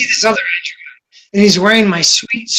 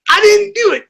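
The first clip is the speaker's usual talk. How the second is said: high denial; 12 semitones higher than usual. Retold or imitated. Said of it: "He says".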